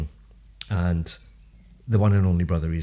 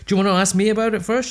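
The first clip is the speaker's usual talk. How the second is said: indignant retort; 12 semitones higher, 6 dB louder, faster than usual. Retold or imitated. Mock-indignant quote of himself.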